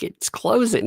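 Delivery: high-pitched, disbelieving echo; mock dismay. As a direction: play the stunned dad. Dad echoes the news in dismay.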